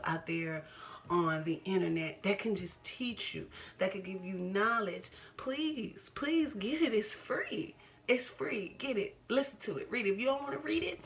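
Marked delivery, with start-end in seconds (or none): 5.4-11.0 high, pressing plea; pleading with empathic viewers; urgent pleading. Real, not acted. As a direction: beg them to go learn.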